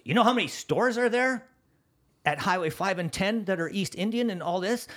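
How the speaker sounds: high-pitched, loud challenge to a newcomer; berating interrogation. Retold or imitated. Program lady grilling a would-be store owner, berating. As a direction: imitate a harsh program boss grilling someone. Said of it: "That's exactly how she spoke".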